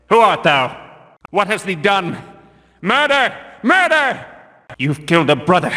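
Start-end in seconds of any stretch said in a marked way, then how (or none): 2.8-4.3 high, shouted alarm cry. Acted. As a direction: yell it out to raise the guard. Guard discovers a killed brother.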